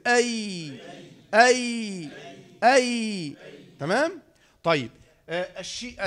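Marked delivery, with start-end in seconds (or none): 0.1-3.3 "أي" with the y held as air flows mid-tongue; sustained y at the end of "أي"; ya airflow demo. Shown as a demonstration. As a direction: let "أي" glide out long. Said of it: "الهواء بيخرج من منتصف كده".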